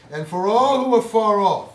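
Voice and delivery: booming pulpit delivery; preaching at full force. Acted. Preaching emphasis on scripture.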